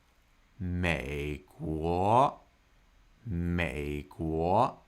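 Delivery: "美国" stretched out, low, then rising; slow practice. Shown as a demonstration. Teacher helping learners practise a hard combination, very slow low-rising "美国". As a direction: trudge slowly through both tones of "美国". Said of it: "maybe start slowly".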